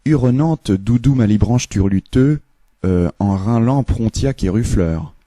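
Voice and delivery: French-sounding gibberish; fake French. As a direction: babble like French. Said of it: "now we do the French".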